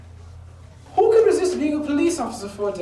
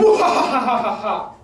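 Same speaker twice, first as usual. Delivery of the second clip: evil laugh; 3 dB louder than usual. Acted. Villain gloats over his plan.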